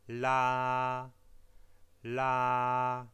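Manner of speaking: "La" held long on one level note, loud; high flat tone. Shown as a demonstration. Teacher showing the Mandarin first tone, high and flat. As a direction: sustain "La" evenly like a sung note. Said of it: "relativement haut et plat, comme lorsque l'on chante la note la".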